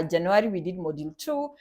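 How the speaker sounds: ordinary talk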